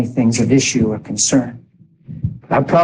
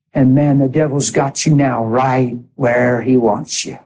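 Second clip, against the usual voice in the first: ordinary talk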